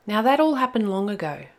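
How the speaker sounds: put-on lively read-aloud for children; story narration. A narrator's reading. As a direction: tell it like a bedtime tale.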